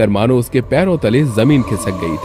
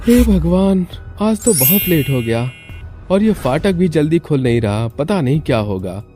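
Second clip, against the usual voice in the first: higher-pitched fretting to himself; worried man; 6 semitones higher than usual. Acted. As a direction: fret aloud, starting high on the exclamation. (परेशान आदमी की ऊँची आवाज़)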